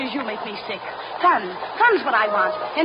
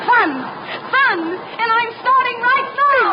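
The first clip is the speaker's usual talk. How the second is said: defiant shout; 10 semitones higher, slower than usual. Acted. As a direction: fling it at her as you run. Girl shouts defiance, runs off.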